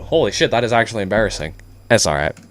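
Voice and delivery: ordinary talk, nothing marked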